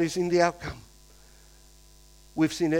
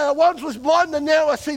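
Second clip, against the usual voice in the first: higher, louder, joyous proclamation; joyful proclaiming; 8 semitones higher, 5 dB louder than usual. Retold or imitated. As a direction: tell the whole town, full of joy. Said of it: "they're going around saying".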